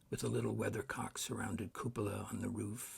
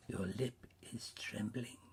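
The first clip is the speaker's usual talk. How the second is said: soft whispery ghost; hushed spirit; 4 dB quieter than usual. A narrator's reading. Ghost noticing Scrooge's trembling lip, hushed. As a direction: observe it in an airy hush.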